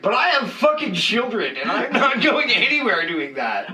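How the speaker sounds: high, laughing exasperated protest; drawn-out, high comic objection; mock refusal. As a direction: push back on a wild invitation, half laughing. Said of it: "the next one is like".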